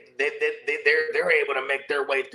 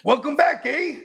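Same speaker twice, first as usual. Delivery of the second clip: high, hammed-up Canadian eh; playful mock Canadian, up high; mock Canadian accent; 13 semitones higher, 4 dB louder than usual. Mocking Canadians on the show, high hammed-up Canadian accent. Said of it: "They don't even really talk like that".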